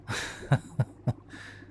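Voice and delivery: drawn-out gloating chuckle; amused villain laugh. Acted. Villain hears the hero failing to speak, amused chuckle. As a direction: laugh lightly at a helpless captive.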